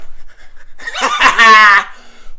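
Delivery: a big burst of real laughter; loud laugh. Real, not acted. Fan cracking himself up, loud real laugh. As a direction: laugh out loud at your own joke. Laughs at his own joke.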